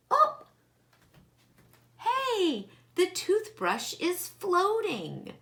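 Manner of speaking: Oh! Hey, the toothbrush is floating, high and amazed; surprised. Real, not acted. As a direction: caught off guard by the result.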